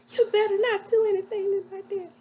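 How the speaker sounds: high, loud warning cry; alarmed retold warning. Daughter warns the man threatening her daddy, loud and high.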